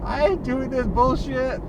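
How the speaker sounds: half-joking mock rant; fed-up outburst. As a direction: blurt it out like you've had enough. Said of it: "That's what they would be saying".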